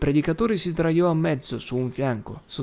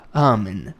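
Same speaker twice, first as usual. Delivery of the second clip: a loud, low amen; solemn loud amen; firm amen; 3 semitones lower, 5 dB louder than usual. Acted. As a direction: close it firmly. Casey ends prayer firmly.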